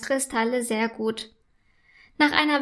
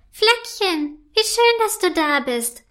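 higher, louder happy elf; overjoyed to see her friend; delighted call; 7 semitones higher, 3 dB louder than usual. A narrator's reading. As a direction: ice elf greeting her friend with joy. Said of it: "ruft sie erfreut".

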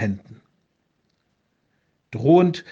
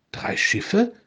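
higher, quieter, amazed rising question; astonished question; 8 semitones higher, 4 dB quieter than usual. Acted. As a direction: echo it in disbelief. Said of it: "Der Bischof staunte".

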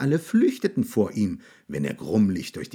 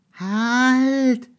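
high squeaky mouse cry; tiny mouse yelling for the monster to stop; mouse shouting; 6 dB louder than usual. A narrator's reading. Little mouse shouts at the monster to stop, high and loud. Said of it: "rief die kleine Maus".

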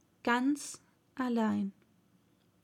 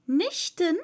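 high, curious exclaimed question; higher-pitched girlish query; called out; 6 semitones higher, 4 dB louder than usual. A narrator's reading. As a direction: call it out as an eager question. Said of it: "rief Alice".

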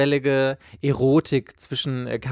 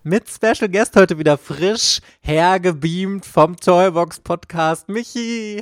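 announcer voice; 5 semitones higher, 5 dB louder, slower than usual. Acted. Host announces guest.

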